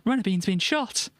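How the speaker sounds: high mock alarm. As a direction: cry it out in cartoon shock. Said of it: "And she goes".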